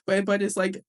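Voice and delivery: ordinary talk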